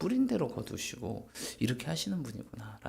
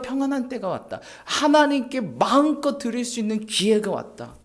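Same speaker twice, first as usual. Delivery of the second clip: higher, louder, impassioned sermon climax; full-force preaching; 9 semitones higher, 10 dB louder than usual. Real, not acted. Pastor exhorts congregation in lockdown, fiery preaching.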